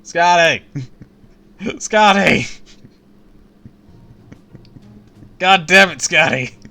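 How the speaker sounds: loud exasperated shouts of Scotty; calling out. Acted. Player shouting Scotty's name at her, loud and exasperated. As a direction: yell her name across the room, fed up.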